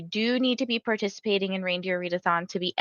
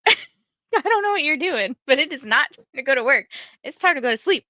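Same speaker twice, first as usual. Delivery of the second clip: laughing scolding; 5 semitones higher than usual. Retold or imitated. Wife scolds sleepwalking husband, laughing.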